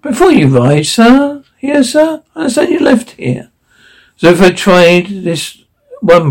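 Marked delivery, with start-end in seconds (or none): 0.0-3.5 high-pitched, politely persistent waiter; mildly insistent servant, lifting each phrase; dogged. A narrator's reading. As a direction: press the point politely, pitched up. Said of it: "persisted the waiter doggedly".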